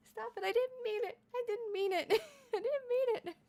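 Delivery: high, whiny fake sob, laughing through it; mock whimpering plea. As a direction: ham up a pleading whimper, giggling. Mock pleading with chat.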